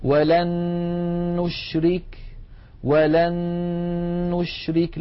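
ولن نشرك recited with both noons fused into one long, held nasal n; merged doubled noon. Shown as a demonstration. Teacher showing students an example of noon merging, merged held noon in ولن نشرك. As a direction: hold the joined n in ولن نشرك before releasing it. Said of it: "صارت مع النون الثانية نونا واحدة مشددة".

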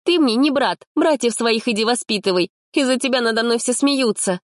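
high bitter rebuke; angry girl. A narrator's reading. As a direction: lash out at him. Polina angry at Sanya.

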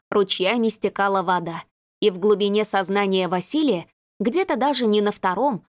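ordinary delivery, nothing marked

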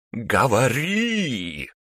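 slow, high, eerie barked command; otherworldly bark. Acted. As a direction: bark it like a demon. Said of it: "рявкнула она потусторонним голосом".

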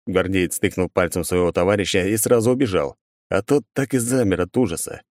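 ordinary delivery

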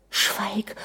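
softly whispered plea for silence; urgent hush. A narrator's reading. Anna begs her raging husband to stop, hushed. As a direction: hush him under your breath.

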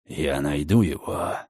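low, soft, slow savage hiss; menacing hiss. Acted. Redfern gripping Margaret's arm, low savage hiss. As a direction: hiss the vow through your teeth. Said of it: "прошипел он".

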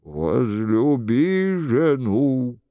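low, slow commandment; understated wrath, intoned deep and drawn out; stern anger. A narrator's reading. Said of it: "с гневом произнёс голос".

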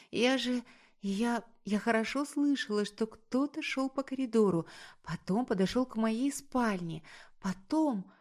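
high, hushed, faltering account; frightened whispering. A narrator's reading. Woman telling him someone was in her house, frightened whispering. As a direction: start in a whisper, stay high and scared. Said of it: "шепчу".

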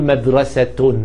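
مدرسة as madrasatun; ending pronounced -tun. Shown as a demonstration. Teacher explaining the two dots, مدرسة ending in -tun. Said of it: "عشان هتقول مدرسة".

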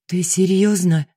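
usual speaking voice

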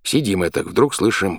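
ordinary delivery

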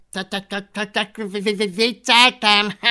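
high stammering creature voice ending in laughter; stuttering dragon. Acted. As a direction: stutter the syllables, then cackle loudly. Fogatlan stutters he was joking.

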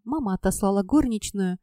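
ordinary delivery, nothing marked

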